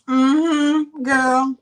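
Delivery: put-on high, loud sassy "Mm-hmm, girl"; sassy pouty voice. Seller voicing a smirking Baileys cup: sassy and pouty. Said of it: "He's got that pouty lip, like".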